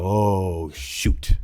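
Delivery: long drawn-out "oh", breath-only "shoot"; retold dismay. Provider hearing of a two-week wait, mock-dismayed. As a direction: play up the sinking realization.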